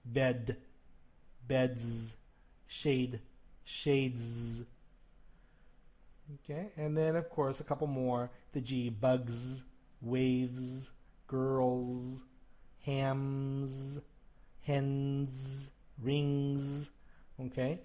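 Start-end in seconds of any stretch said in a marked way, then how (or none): 0.0-4.6 "beds", "shades" end in a buzzed z; voiced z endings held; exaggerated voiced ending. Shown as a demonstration. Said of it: "I'm emphasizing these and exaggerating the sound". 8.5-16.9 "bugs" slow, z endings buzzed; "girls", "rings" with a voiced z; exaggerated z endings. Shown as a demonstration. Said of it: "you can hear the voice at the end".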